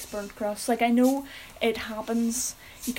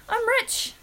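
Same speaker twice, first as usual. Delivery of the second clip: cake voice; 12 semitones higher than usual. Pretend. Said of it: "then it's like".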